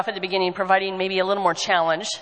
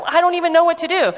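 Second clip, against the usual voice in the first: exasperated; 10 semitones higher, 4 dB louder than usual. Retold or imitated. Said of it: "and I'm like".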